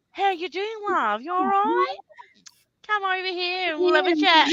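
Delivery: high, sing-song mock Australian; badly faked Australian chat; really bad Aussie accent. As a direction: greet a workmate in hammed-up Aussie. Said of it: "slide into this really shit Aussie accent".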